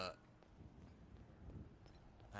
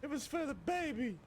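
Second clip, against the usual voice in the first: very high, loud squeal of apology; over-the-top drama, squeaky and loud; mock pleading betrayer; 7 dB louder than usual. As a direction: plead in a hammed-up falsetto wail. Betrayer answers Vinny.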